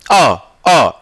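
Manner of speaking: अ, अ voiced deep from the throat; teacher shows where अ comes from; throat vowel demo. Shown as a demonstration. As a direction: press the throat and sound अ, अ. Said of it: "यहाँ दाब कर देखिएगा".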